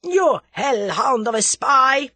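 loud, high, drawn-out fury; screamed accusation. A narrator's reading. Boris turns on the spy, screaming. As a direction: scream it in outrage. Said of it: "he screamed".

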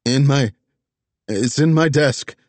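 high, loud hurried answer; frantic insisting. Acted. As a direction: blurt it out in panic. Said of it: "Vess insisted".

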